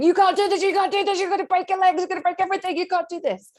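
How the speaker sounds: high, loud, frantic warnings; panicked inner voice. Retold or imitated. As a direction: shriek it like your fear is taking over. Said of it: "that voice in my head was screaming".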